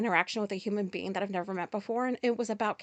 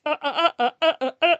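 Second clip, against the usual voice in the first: real laughing fit; 5 semitones higher, 9 dB louder, faster than usual. Real, not acted.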